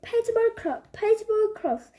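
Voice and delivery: usual speaking voice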